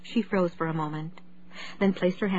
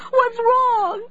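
high, loud alarmed cry; 7 dB louder than usual. A narrator's reading. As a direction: demand it in panic. Panicked husband at wife's side.

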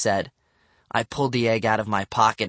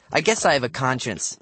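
usual speaking voice

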